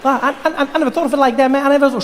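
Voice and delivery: high, stammering rush; rushed young voice. Acted. Barack reacts to Mandela's advice, high and rushed. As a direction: babble on in a high-pitched hurry. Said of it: "Slow down".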